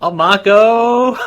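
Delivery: echoed call. Acted. Echoing the called name.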